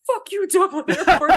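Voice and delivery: high, loud gloating shout; triumphant jeer. Retold or imitated. Leaving Dublin Airport with the butter, a gloating shout. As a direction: crow over the victory.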